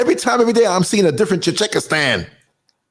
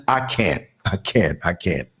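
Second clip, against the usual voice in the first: each I can't softer and lower, fading away; 12 semitones lower, 5 dB quieter than usual. Pretend. Saying the show's title to viewers, fading to a weary murmur. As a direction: let the words sink into a defeated murmur. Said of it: "like the title of the show says".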